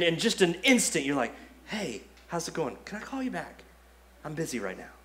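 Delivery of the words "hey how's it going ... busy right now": much softer, casual and friendly, as if nothing happened; sudden polite phone voice. Pretend. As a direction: flip the switch from yelling to a breezy, quiet hello. Mid-argument, switches to calm phone voice.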